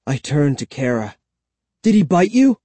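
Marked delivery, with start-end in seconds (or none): 1.8-2.6 higher, anxious question; choked-out question. A narrator's reading. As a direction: ask it in a worried rush of alarm. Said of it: "I choked out".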